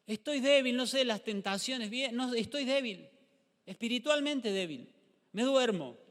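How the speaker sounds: loud, high mock complaining believer; hammed-up weak believer moaning; whiny weak believer. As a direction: whine it out like a sleepy churchgoer. Pastor voices weak believer complaining.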